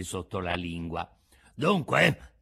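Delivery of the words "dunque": menacing demand. A narrator's reading. Assassins threaten.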